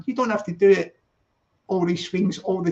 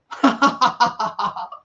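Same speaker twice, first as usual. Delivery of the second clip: rolling belly laugh of amusement; hearty laugh; 4 semitones higher than usual. Real, not acted. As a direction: burst out in a run of laughter. Amused while chatting to viewers.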